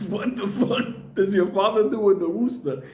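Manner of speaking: high-pitched mock disbelief; incredulous comic outburst. Acts out shocked reply to girlfriend.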